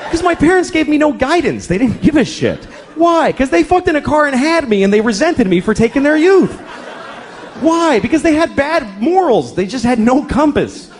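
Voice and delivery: spiral into frustrated confession; escalating exasperation. Retold or imitated. Dad cornered by endless whys.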